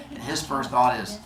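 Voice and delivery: usual speaking voice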